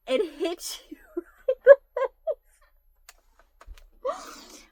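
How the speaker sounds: words broken by real high laughter; laughing through words. Real, not acted. Telling viewers the punchline, breaking into laughter. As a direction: try to finish through the giggles.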